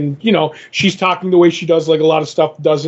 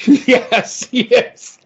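laughing yes; 6 semitones higher than usual. Real, not acted. Agreeing while still laughing.

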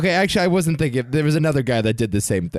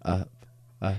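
sexy voice; 4 semitones lower than usual. Pretend. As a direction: read it like a seductive come-on. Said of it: "start reading in your sexy voice".

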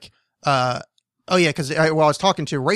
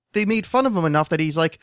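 ordinary talk, nothing marked

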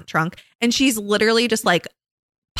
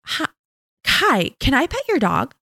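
put-on higher eager little kid asking; toddler voice; 7 semitones higher than usual. Mom imitating her three-year-old asking strangers to pet their dog, higher kid voice. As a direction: pitch up, sweet and eager. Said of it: "she goes".

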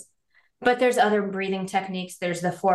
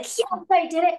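triumphant cheer; 9 semitones higher than usual. Retold or imitated. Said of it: "You can be like".

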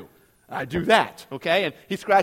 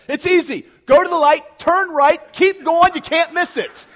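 much louder and higher breezy directions; loud brush-off; 8 semitones higher, 10 dB louder than usual. Retold or imitated. Trooper dismissing a lost driver, loud breezy directions. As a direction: bellow the fake directions cheerfully.

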